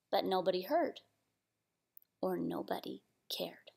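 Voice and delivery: very soft and low, fading to whisper; hushed, forlorn. A narrator's reading. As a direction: let it sink away sadly. Sad moment in story read aloud.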